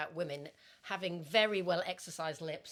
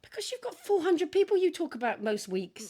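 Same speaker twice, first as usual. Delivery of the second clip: louder, exasperated defence; 8 semitones higher, 6 dB louder than usual. Real, not acted. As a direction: protest it. Defending forgetting names.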